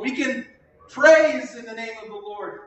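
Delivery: preacher rising on praise. Real, not acted. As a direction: praise punched high and loud.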